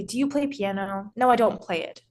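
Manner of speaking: usual speaking voice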